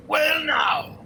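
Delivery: high, loud startled exclamation; dwarf shocked at losing; surprised dwarf. Acted. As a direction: caught off guard by the loss. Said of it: "he's like".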